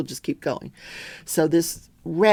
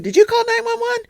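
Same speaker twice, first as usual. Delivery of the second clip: exasperated demand; 5 dB louder than usual. Retold or imitated. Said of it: "I'm like".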